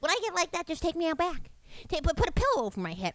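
the mother asking to be taken out back; high breezy mom impression; imitating mom. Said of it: "she's like".

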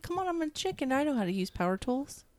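high, soft mock protest; mock indignant. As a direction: play offended, mock pouty. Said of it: "I was like".

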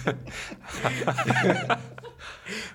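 high unvoiced giggle; breathy laugh. Real, not acted. Laughing at the joke.

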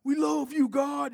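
high excited cry of praise; imitating a joyful shout to God; yelled praise. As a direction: call it out like a street preacher. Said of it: "always yelling".

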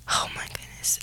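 real lost voice, air only; voice gone. Real, not acted. Telling viewers she's still recovering her voice, a faint whisper. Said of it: "I'm still getting my voice back".

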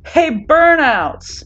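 drawn-out shouted greeting; yell. A narrator's reading. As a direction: call out loudly from far off. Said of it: "Bakugo yells at them".

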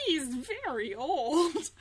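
slow, high, loud and laughing; mock horror. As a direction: gasp it out in fake shock. Mock-shocked quote about his age.

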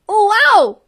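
put-on high excited child cry. A narrator's reading. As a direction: burst out in high delight. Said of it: "cried the little boy".

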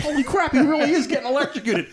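high, laughing shock; laughing surprise. Retold or imitated. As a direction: laugh out the realization. Retold shock realized.

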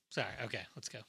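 normal talking voice

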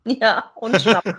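words slowed by a laugh; amused, half-laughing remark. Real, not acted. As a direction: laugh through it slowly. Joking about the Schnaps gag.

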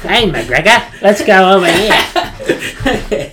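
slow and laughing through it; laughing soldier impression. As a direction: drag it out while cracking up. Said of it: "Maybe it's not that forced".

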